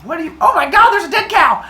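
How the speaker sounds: high, loud shriek of shock; hammed-up screechy panic; horrified yelling. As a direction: cry out at a gruesome discovery. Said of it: "start yelling back and forth".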